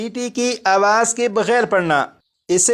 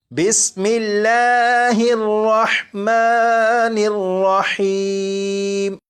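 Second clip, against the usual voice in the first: slow, drawn-out Quranic recitation; much slower than usual. Shown as a demonstration. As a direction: chant it slowly like tilawat. (آہستہ، کھینچ کر قرآنی تلاوت)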